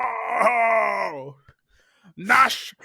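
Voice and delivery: high mock scream, drawn-out whoa; Bushwhackers scream. Wrestler hyping a promo, imitated screaming. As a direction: holler like a wild tag team. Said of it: "just the Bushwhackers screaming for a minute".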